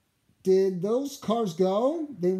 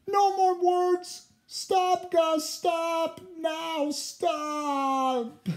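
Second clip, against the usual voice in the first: furious; 11 semitones higher, slower than usual. A narrator's reading. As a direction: yell at him in fury. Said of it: "Sam was furious".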